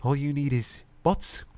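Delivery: slow, puzzled rising question. Acted. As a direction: ask it unsure. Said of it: "I question".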